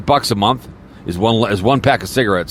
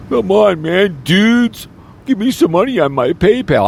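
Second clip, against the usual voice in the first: much higher, pleading put-on voice; mock begging streamer; 12 semitones higher, slower than usual. Podcaster mocking streamers who beg for donations, high whiny plea.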